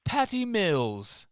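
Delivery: put-on loud, high hammy Australian accent. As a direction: overdo an Aussie accent. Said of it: "the Australian accent".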